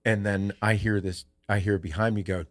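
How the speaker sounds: ordinary talk, nothing marked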